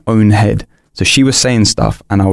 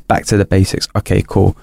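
ordinary talk, nothing marked

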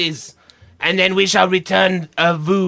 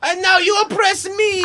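whiny complaint; 12 semitones higher than usual. Acted. Guard complaining, high.

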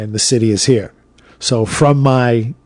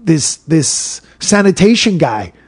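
usual speaking voice

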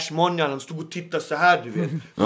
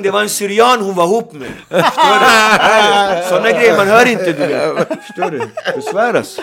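loud laughing, half shouting; 4 semitones higher, 10 dB louder than usual. Real, not acted. Tells the hosts the Carola gossip, laughing loudly. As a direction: laugh through the words, much louder and higher.

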